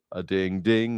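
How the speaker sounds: ordinary talk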